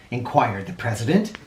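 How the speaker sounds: ordinary delivery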